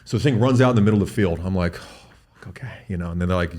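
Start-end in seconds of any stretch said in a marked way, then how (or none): 1.8-2.8 dismayed whisper. Retold or imitated. Hunter dismayed as bull flees.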